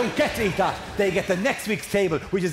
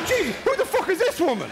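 much higher, mock-alarmed kid voice; kids' outburst; 13 semitones higher than usual. Kids reacting to a stranger in the house, mock outburst. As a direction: exclaim it high, as an imitated startled child. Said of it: "And the kids go".

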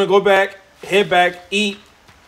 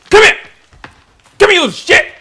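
much higher and louder, chasing down prey; frustrated yell; 9 dB louder, faster than usual. Real, not acted. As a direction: holler at the rabbit running away. Chasing a rabbit.

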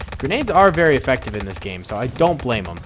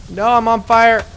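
high, loud, slow panicked cry; alarmed outcry; 9 semitones higher, 6 dB louder, slower than usual. Real, not acted. Caught by French flamethrowers, a loud panicked outcry. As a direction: yelp as the flames hit. His soldier set on fire.

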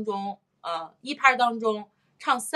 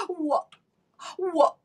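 sharp inhale, then 我; breath before speaking; 8 semitones higher than usual. Shown as a demonstration. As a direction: gasp and release 我 on the off-beat. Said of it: "他会吸一口气才说".

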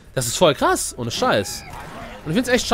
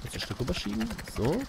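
usual speaking voice

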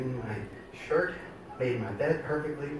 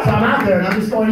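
ordinary delivery

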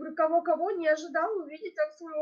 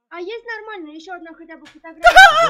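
alarmed shout; 2 semitones higher, 17 dB louder than usual. Real, not acted. Demanding a clearer photo.

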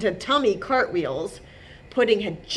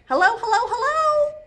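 high, loud calling-out; chipmunk shouting hello; 5 dB louder than usual. A narrator's reading. Jitterbug calling to goose and otters, shouting. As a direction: shout a squeaky triple greeting. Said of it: "shouted Jitterbug".

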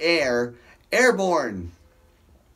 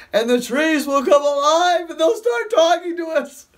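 louder and higher, laughing through the words; 10 semitones higher, 5 dB louder than usual. Real, not acted. Preacher cracking up at his talking-trees joke, laughing loud.

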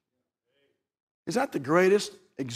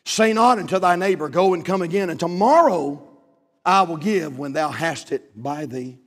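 scripture reading; 5 dB louder than usual. Acted. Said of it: "says it like this".

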